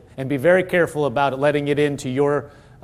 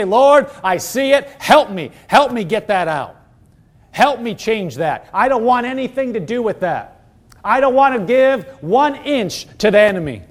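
higher, earnest plea to God; pleading prayer; 6 semitones higher, 4 dB louder than usual. Acted. Preacher models asking the Lord for help, pleading prayer. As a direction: pray it out with rising urgency and resolve. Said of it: "we say".

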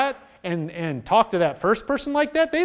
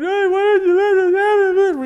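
higher, louder whiny demand; mock angry complaint; 13 semitones higher, 6 dB louder than usual. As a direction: throw a petulant accusation at God. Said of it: "we're getting mad at him going".

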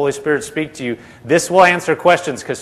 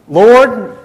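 high, loud appeal of disbelief; disbelieving protest; 7 semitones higher, 7 dB louder than usual. Retold or imitated. Brother Hagin questioning what Jesus said, imitated loud disbelief. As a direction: call out "Lord" as a baffled objection.

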